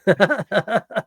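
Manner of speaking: a burst of helpless laughter. Retold or imitated. Retelling his reaction to kids running, helpless laugh. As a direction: crack up at the memory. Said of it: "I just sat there and went".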